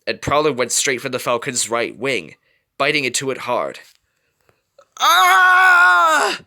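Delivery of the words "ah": high loud cry of pain; pained scream. A narrator's reading. Falcon bitten through the wing, loud high scream of pain. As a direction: shriek as teeth pierce the wing. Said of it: "Perry screamed in pain".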